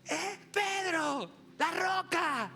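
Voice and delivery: high, loud, stretched-out holler; calling out. Acted. Hailing the rock from afar, high drawn-out shouting. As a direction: holler a nickname proudly across a distance.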